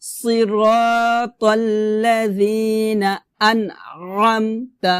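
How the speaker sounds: pressed throat ayn in drawn-out "আনআমতা"; tajweed recitation. Shown as a demonstration. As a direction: recite "সিরাতাল্লাযিনা আনআমতা" slowly, holding long vowels. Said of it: "আমি আবার বলি".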